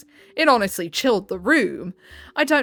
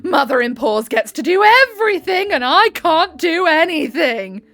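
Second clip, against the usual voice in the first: acted shout; 2 semitones higher, 5 dB louder than usual. Mother-in-law yelling at cousin-in-law in the hospital, loud indignant shout. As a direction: shout it like a wounded, furious mother. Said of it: "shouting to act out that glorious scene".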